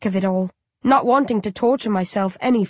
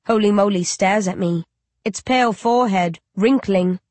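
usual speaking voice

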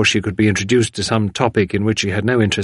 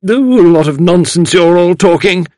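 put-on loud, high, dismissive old woman; grim woman; 8 semitones higher, 7 dB louder than usual. A narrator's reading. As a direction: scoff loudly at the others.

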